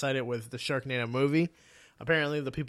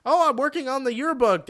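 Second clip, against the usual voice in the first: a little higher, eager nerd voice; lightly voiced nerd; 8 semitones higher, 5 dB louder than usual. Nerd kid explaining his yearbook work, lightly higher eager voice. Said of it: "the nerd kid is like".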